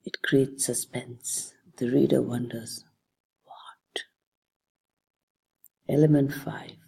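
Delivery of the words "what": very soft, wondering question; hushed wondering. Acted. Teacher voices a reader wondering after a hook, hushed. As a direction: let it out very softly, as if puzzled.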